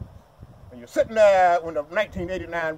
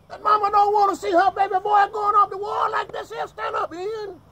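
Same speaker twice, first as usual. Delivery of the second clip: high, loud preacher's cry; impassioned shout; 12 semitones higher, 5 dB louder than usual. Real, not acted. Speaker tells the crowd of mothers losing sons, high loud cry.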